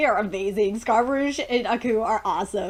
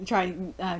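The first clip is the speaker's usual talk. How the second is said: ordinary talk, nothing marked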